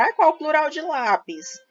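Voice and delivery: wondering pupil. Pretend. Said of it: "vocês já se perguntaram".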